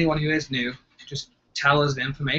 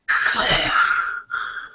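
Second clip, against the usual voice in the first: long, heavy, rasped breathing; Darth Vader breath. Retold or imitated. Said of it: "like, a Darth Vader breath".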